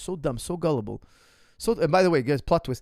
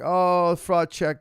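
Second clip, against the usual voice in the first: mocking crowd; 3 semitones higher, 7 dB louder, much slower than usual. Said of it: "everybody's like".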